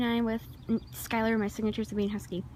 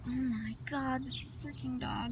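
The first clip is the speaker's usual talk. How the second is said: exasperated muttering; 3 semitones higher, 8 dB quieter, slower than usual. Retold or imitated. Exasperated at the runaway dog.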